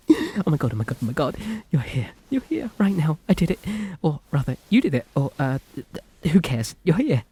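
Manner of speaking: high, tumbling, breathless rush of joy; giddy excitement. Acted. Captor gushing to her captive, giddy and excited. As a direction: babble and trip over the words in delight. Overjoyed captor finally has listener.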